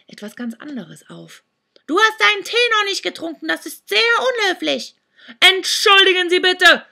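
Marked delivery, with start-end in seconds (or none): 1.9-4.9 fast, much higher, louder crone voice; scolding old hag. Acted. Old woman scolds the boys. 5.4-6.8 much louder, higher shout into the ear horn; bellowing apology. Acted. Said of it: "brüllte Sandro".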